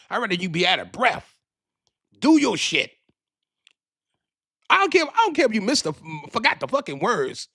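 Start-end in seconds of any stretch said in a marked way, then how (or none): 2.2-7.5 fired-up shout. Real, not acted. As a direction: cheer her on from the seats. Urging her on.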